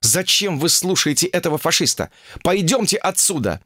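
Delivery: high-pitched exasperated appeal; indignant urging. Acted. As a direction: urge them away from the guru. Indignant woman urges them away. (возмущённо уговаривая)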